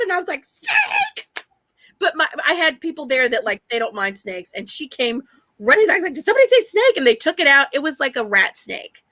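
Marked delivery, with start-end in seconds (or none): 0.4-1.5 very high squeal, held back in volume; thin, sky-high mock scream; retold shriek. Retold cry on seeing snake. 6.1-7.0 high, perky question; imitating someone excitedly butting in; imitated eager question. Imitating coworker who came running.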